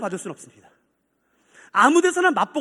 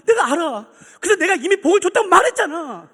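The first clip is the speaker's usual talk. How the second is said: raised, loud acted insistence; high, exasperated divine reply; exasperated God; 6 semitones higher, 6 dB louder than usual. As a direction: cut through the complaint emphatically. God's exasperated answer to complainer.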